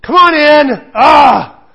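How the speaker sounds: loud, high mock-angry yell; shouting. Dad shouting at son over the shower, mock yell. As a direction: bellow it through the shower noise. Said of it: "I'll even go".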